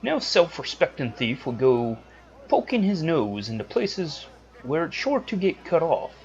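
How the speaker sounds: high, put-on accented voice for Tomy; hammed-up high thief voice; horrible accent. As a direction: play the thief in a bad accent. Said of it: "my horrible accent".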